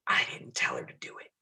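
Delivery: hushed, voiceless aside, as if letting slip a secret; whispered confession; confidential whisper. Pretend. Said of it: "I just whispered that".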